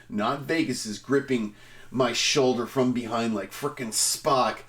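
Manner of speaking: normal talking voice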